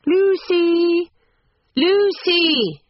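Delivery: high, drawn-out call. A narrator's reading. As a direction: call the name from a distance, pitched very high. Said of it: "called voices from the carriage".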